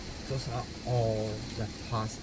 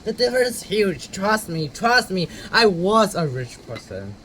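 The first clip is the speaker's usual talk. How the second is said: emphatic; 5 semitones higher, 7 dB louder than usual. Real, not acted. Emphatic insistence on rich vs poor.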